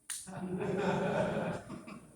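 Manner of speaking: high breathy giggling laugh; drawn-out high chuckle; amused laugh. Real, not acted. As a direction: laugh at your own cheek. Laughs at his own defiance.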